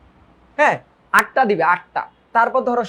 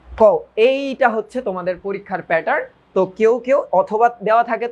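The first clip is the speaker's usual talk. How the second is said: normal talking voice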